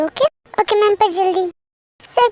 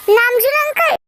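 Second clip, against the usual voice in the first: raised, higher attention-getting call; insistent calling out; 5 semitones higher, 3 dB louder than usual. Acted. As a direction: call him out of his thoughts. Second call to get attention.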